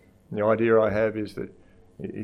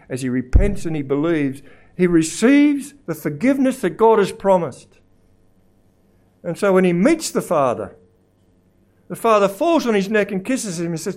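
high, loud, emphatic sermon; full-force preaching; 9 semitones higher, 8 dB louder than usual. Real, not acted. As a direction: drive each phrase home from the pulpit.

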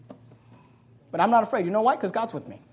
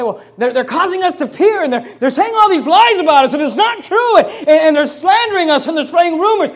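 higher, louder worried complaining voice; anxious believer quoted; 9 semitones higher, 10 dB louder than usual. Retold or imitated. Pastor voices a fearful church member complaining, higher and agitated. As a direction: play a flustered believer piling up grievances. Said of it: "you, you say".

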